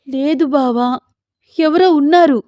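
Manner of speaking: high, urgent insistence; frightened wife. Acted. Sita insists to her husband someone is there, alarmed. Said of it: "నాకు భయమేస్తోంది".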